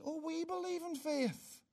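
high, very soft mimicking voice; voice of Rome. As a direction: quote the opponents in a small, high voice. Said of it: "Because Rome says this".

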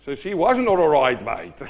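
loud setup breaking into a laugh on "mate"; tickled by own joke; amused punchline. Real, not acted. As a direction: deliver the punchline, chuckling at the end. Punchline in Aussie phrase, laughing.

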